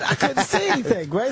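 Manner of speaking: normal talking voice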